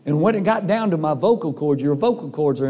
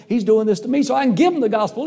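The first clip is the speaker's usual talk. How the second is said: higher and louder, bursting with joy; excited; 6 semitones higher, 3 dB louder, faster than usual. Retold or imitated. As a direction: blurt the news to your wife, thrilled. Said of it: "I was so excited".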